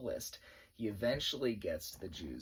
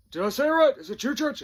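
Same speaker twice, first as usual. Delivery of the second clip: loud, high questioning bark; drill sergeant shout; 14 semitones higher, 10 dB louder than usual. Retold or imitated. Drill sergeant checking the name with a recruit, shouted.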